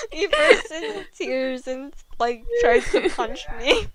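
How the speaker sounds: laughing through the words, real giggles. Real, not acted. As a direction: crack up mid-sentence while reading. Laughing while reading fanfic.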